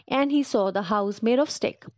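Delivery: ordinary delivery, nothing marked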